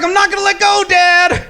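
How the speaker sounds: high, loud squeaky reassurance; lightly mocking a dad promising; a hint of a squeaky dad; mock dad reply. Dad answering, voiced high.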